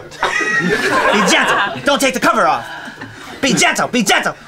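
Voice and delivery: high, loud, exasperated command; mock mother yelling. A mock caring mother to her tiny child, yelling. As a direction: yell it like a mother at her limit. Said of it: "stop yelling at me".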